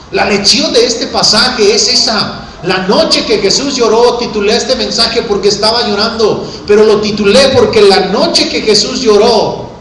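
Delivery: high, forceful preaching; prédica enfática. Acted. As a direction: hammer home the lesson to the congregation. Recalca el título del mensaje. (predicación enfática, en tono alto)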